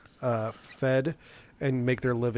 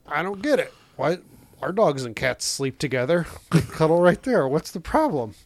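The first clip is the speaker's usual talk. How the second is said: put-on higher, innocent puzzled child; confused kid voice; 3 semitones higher, 4 dB louder than usual. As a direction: protest like a baffled little kid. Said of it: "And I was like".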